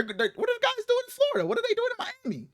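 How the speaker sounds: high, fast, baffled squeak. Retold or imitated. Two friends baffled by a tweet, high fast squeak. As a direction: squeak out the confusion, high and quick. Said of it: "both of us are like".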